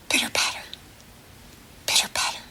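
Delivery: soft unvoiced pitter patter; whispered rain sound. Shown as a demonstration. Teacher shows children the rain sound, whispered. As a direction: whisper it like light rain on a leaf. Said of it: "what sound does the rain make".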